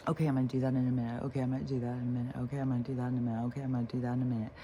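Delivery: fast looping self-talk, same phrase over and over; rapid repeated mantra. Retold or imitated. Rushing through a repeated excuse to herself, fast. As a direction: rattle it off on a loop. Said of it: "I'll just keep repeating the same phrase".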